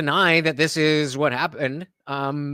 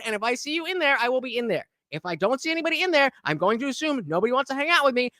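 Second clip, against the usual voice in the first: normal talking voice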